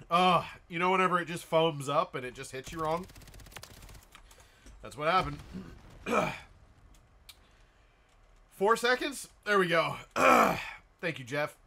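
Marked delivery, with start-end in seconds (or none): 5.4-6.6 cough. Real, not acted. Coughing fit continues.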